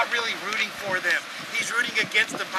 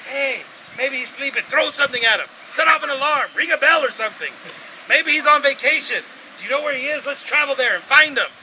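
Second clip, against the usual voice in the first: higher, louder mock helpfulness; sarcastic suggestions; 6 semitones higher, 6 dB louder than usual. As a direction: pretend to help while mocking. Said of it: "He's trying to wake him up".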